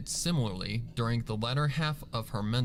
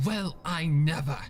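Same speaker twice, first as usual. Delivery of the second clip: scoffing; 5 semitones higher, 4 dB louder than usual. A narrator's reading. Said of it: "Rarity scoffed".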